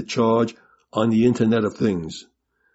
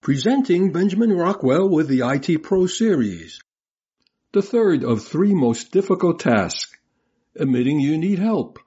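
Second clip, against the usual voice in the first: announcer intro; 4 semitones higher than usual. Acted. Recorded show intro.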